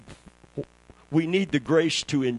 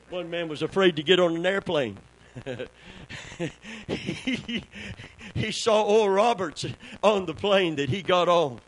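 ordinary talk, nothing marked

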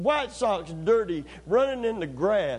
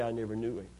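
lower, softer denial; quiet denial; 8 semitones lower, 7 dB quieter, much faster than usual. Acted. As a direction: mutter the lie under your breath. Peter denying Jesus, told.

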